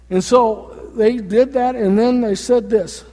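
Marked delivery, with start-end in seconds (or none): none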